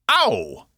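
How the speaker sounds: sudden high, loud "ow"; pained yelp. Acted. Box is hurt while Cass works inside him, pained yelp. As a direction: yelp as if poked mid-sentence.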